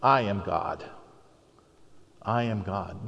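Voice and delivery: low, soft and solemn voice of God; a hushed, weighty divine declaration. Retold or imitated. Preacher voicing God, quiet and solemn. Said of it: "and said".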